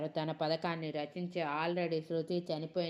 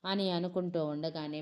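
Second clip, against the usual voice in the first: ordinary delivery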